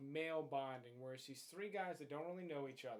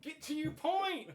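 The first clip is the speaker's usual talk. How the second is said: exasperated shout; 11 semitones higher, 8 dB louder than usual. Real, not acted. Exasperated cohost cuts him off.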